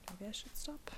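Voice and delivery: hushed, almost whispered; quiet aside. Real, not acted. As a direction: mutter it to yourself. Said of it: "I lost my voice".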